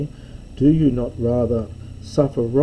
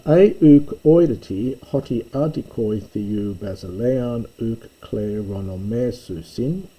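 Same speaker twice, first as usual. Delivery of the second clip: measured, deliberate reading of the Greek text; reading Greek aloud; 5 dB louder than usual. Shown as a demonstration. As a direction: read the verse out carefully.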